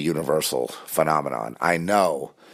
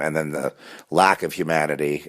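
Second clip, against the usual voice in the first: ordinary delivery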